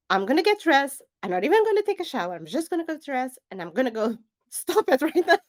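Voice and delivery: high, loud, hurried giving-in, breaking into laughter; mock exasperation. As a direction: snap back at a nagging inner voice. Fed up, answering the inner voice.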